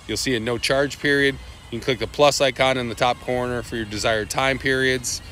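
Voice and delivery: normal talking voice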